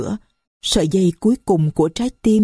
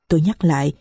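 plain reading voice

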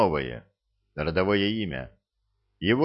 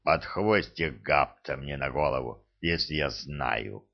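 put-on old orc voice; 6 semitones lower, 4 dB quieter than usual. Hrad speaks again.

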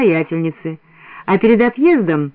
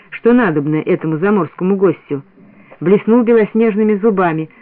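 ordinary delivery, nothing marked

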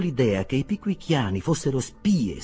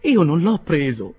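slow, loud indignant denial; affronted, higher and drawn out; 5 semitones higher, 8 dB louder than usual. A narrator's reading. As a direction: protest it firmly, voice raised. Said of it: "rispose indignato mister Pickwick".

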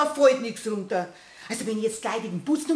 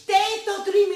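high-pitched argumentative comeback; insistent retort; 7 semitones higher than usual. Acted. Housemaid contradicting the servant, insistent. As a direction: argue straight back, pitch raised.

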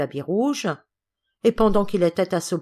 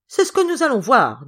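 loud, higher indignant retort; raised voice, offended cousin; 6 semitones higher, 7 dB louder than usual. A narrator's reading. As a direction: take up the challenge, outraged. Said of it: "avec indignation".

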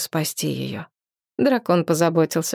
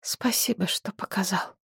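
whispering; 2 semitones higher, 8 dB quieter than usual. A narrator's reading. Grateful hushed thanks.

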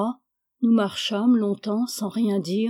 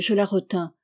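ordinary delivery, nothing marked

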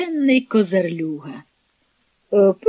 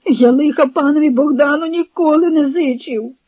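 raised, pleading oath; whining plea; 4 semitones higher, 3 dB louder than usual. A narrator's reading. Captive swears he meant Bohdan no harm, whining. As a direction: protest innocence in a high whine. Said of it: "заскиглив Копил".

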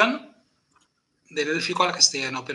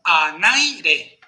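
"anaire" lento, con NAI fuerte y alargado; apóyate en NAI al decir "anaire"; acento en el diptongo; 3 dB louder than usual. Shown as a demonstration. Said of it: "tiene diptongo". ("anaire" silabeado: a-NAI-re)

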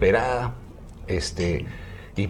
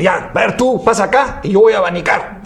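higher and louder, laughing through it; desperate old husband; 9 semitones higher, 5 dB louder than usual. Acted. Old husband pushes the witch doctor aside, laughing. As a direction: grab the fan, impatient and laughing. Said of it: "El hombre desesperado le dice".

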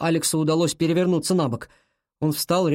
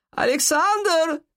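high, drawn-out call from downstairs; energetic granny's high-pitched call; grandmother shouting; 13 semitones higher, much slower than usual. A narrator's reading. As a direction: holler the name up through the house. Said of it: "кричала снизу его бабушка".

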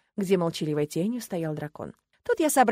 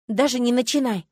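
буркнула; 4 semitones higher, 6 dB louder than usual. A narrator's reading. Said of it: "буркнула я".

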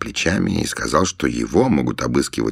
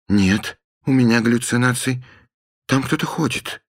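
anxious; 9 semitones higher than usual. Acted. Tata insists, anxious, pitch climbing.

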